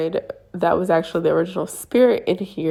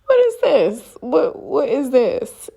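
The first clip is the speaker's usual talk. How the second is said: slow, high mock disbelief; acted-out bewilderment, half laughing at first; baffled; 6 semitones higher, 3 dB louder, slower than usual. Acting out bewilderment to relative.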